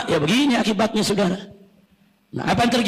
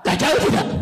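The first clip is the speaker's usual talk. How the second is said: ordinary talk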